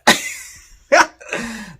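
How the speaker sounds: high, loud cackling laugh; loud burst of laughter. Real, not acted. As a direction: crack up at the reveal. Reveal that the picture lied.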